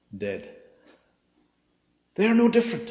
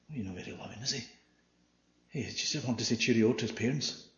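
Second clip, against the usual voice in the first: a lightly voiced muttering churchgoer; congregation grumbling; 2 semitones lower, 8 dB quieter than usual. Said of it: "you can hear the congregation".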